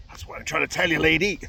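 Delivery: loud, acted outburst at a customer; mock fed-up shout; exasperated punchline. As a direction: raise the voice sharply, building to the last word.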